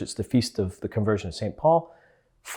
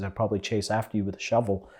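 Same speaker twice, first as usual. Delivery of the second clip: ordinary talk, nothing marked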